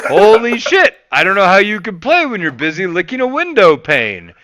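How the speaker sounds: loud, high scornful trash talk; mock toxic rant. Venting at a teammate in game, loud mocking rant. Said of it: "You know, shit like that".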